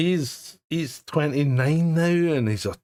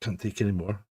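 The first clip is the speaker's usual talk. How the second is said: a lower, softer, worn-out sigh of a line; mock despairing son; 7 semitones lower, 7 dB quieter than usual.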